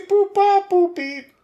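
high robotic beeps; toy robot voice. Acted. Random generator noises.

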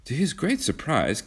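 ordinary delivery

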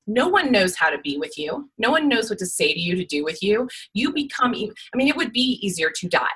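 usual speaking voice

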